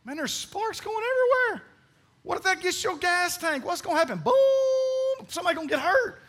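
high-pitched, incredulous outburst; mock alarm. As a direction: squeal it in exaggerated alarm. Acts alarmed reaction to driver.

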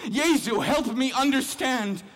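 high, loud crying out to Jesus; anguished loud prayer; desperate plea. Real, not acted. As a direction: beg for understanding at full voice.

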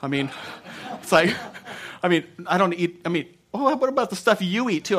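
ordinary talk

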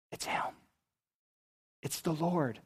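very soft, halting words; hushed recognition. Acted. Disciple realizing who stands there, hushed. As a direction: let it out barely aloud, pausing between.

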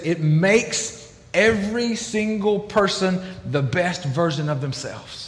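unhurried, gently emphatic; slowly, every single person the best version; slow and deliberate. Retold or imitated. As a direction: lay out each word slowly. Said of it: "He says".

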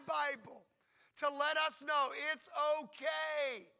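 loud, high, rising sermon climax, slowing on key words; preaching at full force. Acted. Preacher telling the congregation God welcomes complaints, booming. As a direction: build to a booming peak.

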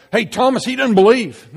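high, loud tattletale call; tattling call. Pretend. Disciples telling Jesus about Thomas, high and loud. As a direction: call out to tell on him.

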